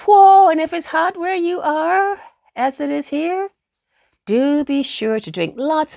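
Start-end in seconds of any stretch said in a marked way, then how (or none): none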